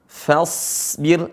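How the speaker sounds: "fasbir" with thin fa and long hissing heavy s; careful model "fasbir", sad sustained; correct pronunciation. Shown as a demonstration. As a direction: keep fa light, stretch the s in "fasbir". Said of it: "Cara bacanya adalah".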